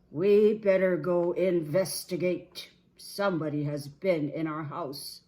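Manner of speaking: big booming bear voice; Papa Bear. Acted. Papa Bear telling the family to investigate, booming. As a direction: boom it out with authority.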